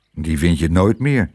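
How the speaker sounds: low, glum resignation; sombre, sunken voice; gloomy. A narrator's reading. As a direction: say it low and hopeless. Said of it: "zei Tos somber".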